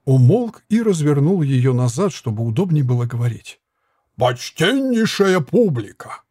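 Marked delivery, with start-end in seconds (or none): 4.2-6.2 slow grand announcement; proclaiming showman. A narrator's reading. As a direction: proclaim it like a fairground barker. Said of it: "провозгласил он".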